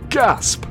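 a mock gasp said aloud; spoken gasp. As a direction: say gasp in faked horror. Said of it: "I gasp in horror".

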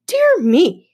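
high, loud mock astonishment; feigned surprise. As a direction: overplay the shock, clearly fake. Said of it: "feigned surprise".

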